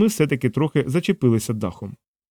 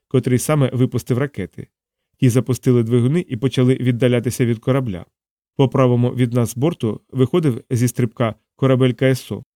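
plain reading voice

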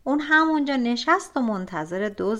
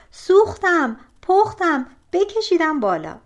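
crying out in pain; 7 semitones higher, 6 dB louder than usual. A narrator's reading. Brother burning in the well.

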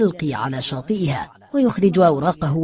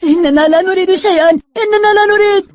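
high, desperate pleading yell; screaming; 14 semitones higher, 8 dB louder than usual. A narrator's reading. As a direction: scream it out, rising higher on the repeat. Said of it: "فواصلوا ضربهم مجددا حتى صرخوا".

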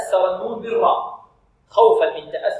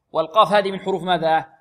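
usual speaking voice

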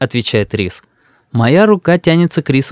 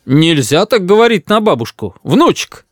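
lightly stern grandfather, higher-pitched; mock-stern; 6 semitones higher than usual. A narrator's reading. Grandfather scolds grandson while pleased, mock-stern. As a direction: pretend to scold him. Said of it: "говорит дедушка якобы строго".

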